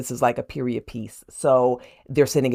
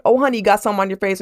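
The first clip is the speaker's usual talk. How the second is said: rushed husband; 5 semitones higher, much faster than usual. Retold or imitated. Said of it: "he's like".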